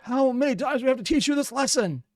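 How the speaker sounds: high-pitched cartoon-style telling-off; mock exasperated scolding. Viewer quoting SpongeBob in a comment, high mock exasperation.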